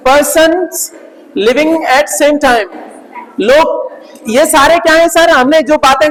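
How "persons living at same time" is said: slow dictation. Shown as a demonstration. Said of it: "dictating the meaning".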